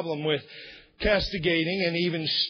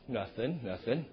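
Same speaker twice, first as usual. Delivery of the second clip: much softer, lower, rising evasive mumble; guilty quiet denial; 8 semitones lower, 8 dB quieter than usual. Shown as a demonstration. Man caught watching something, quietly denying it. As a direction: answer like someone caught staring at a screen. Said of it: "trying to illustrate what the word means".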